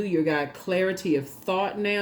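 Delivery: normal talking voice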